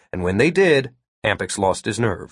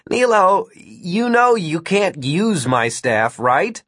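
higher, slow, amused disbelief; amused taunt; 7 semitones higher, slower than usual. Acted. Martin to Nilo about his staff, amused taunt. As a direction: draw it out, teasing your opponent.

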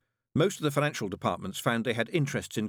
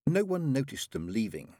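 ordinary delivery, nothing marked

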